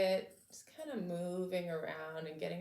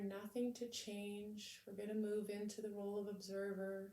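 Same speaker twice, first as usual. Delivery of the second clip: calm, measured meditation voice with long pauses; unhurried, soothing lead-in to stillness; guided meditation; 5 dB quieter than usual. Real, not acted. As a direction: gently guide listeners to settle into the body.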